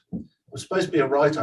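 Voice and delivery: ordinary talk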